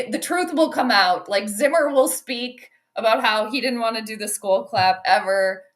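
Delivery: usual speaking voice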